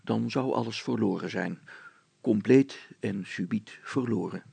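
usual speaking voice

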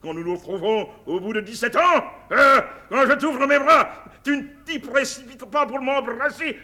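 indignant outburst. Acted. General demanding a hug from his long-lost daughter, indignant. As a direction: build to a big, emphatic appeal.

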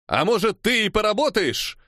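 high-pitched defiant retort; shouting back. A narrator's reading. Striker answering the Cossack commander, shouting. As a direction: throw the challenge back. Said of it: "выкрикнул я в ответ".

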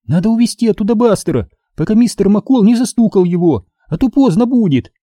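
put-on high-pitched anxious girl; frightened urging. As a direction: urge it high, in alarm. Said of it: "испуганно проговорила Минди". (испуганная высокая речь девочки)

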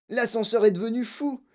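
high, frantic exclamation; panicked grandfather's wail; alarmed yell. A narrator's reading. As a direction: cry out in panic, high-pitched. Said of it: "hurla grand-papa Joe".

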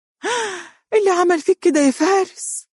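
a mother horrified at her son's wound; anguished gasp. A narrator's reading. Mother seeing her son's injured jaw, anguished high cry. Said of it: "وقالت بلوعة".

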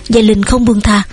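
ordinary delivery, nothing marked

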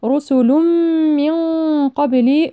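chanted رسل من with a held hummed m; nasal merging. Shown as a demonstration. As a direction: hum through the join of رسل من, then hide the n before قبل. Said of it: "إدغام كامل بغنة". (ترتيل بإدغام رسل من مع الغنة)

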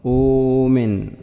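أؤمن said slowly with a long uu; teacher repeats أؤمن with its long waw; slow demo word. Shown as a demonstration. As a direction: stretch the uu in أؤمن deliberately. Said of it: "والحرف الذي يجانس الضمة الواو".

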